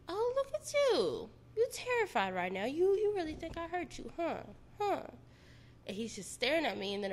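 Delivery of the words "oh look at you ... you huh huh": mocking taunt. Boyfriend mocking her terror with gun raised, high mocking sneer. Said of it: "he's just like".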